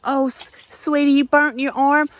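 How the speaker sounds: high, fussing southern auntie; sweet old auntie. Retold or imitated. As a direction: coo at a hurt child like a root-lady aunt. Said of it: "I couldn't even ima".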